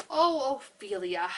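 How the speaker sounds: loud, long-drawn call; calling from afar. A narrator's reading. Kevin calling Ophelia from far off, drawn-out yell. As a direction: holler the name slowly across a distance. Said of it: "came a voice from afar".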